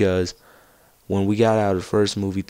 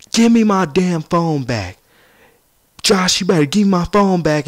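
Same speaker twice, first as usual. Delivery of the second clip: mock angry yell; 10 semitones higher, 7 dB louder than usual. Friend demands his phone back.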